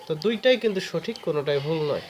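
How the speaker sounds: ordinary delivery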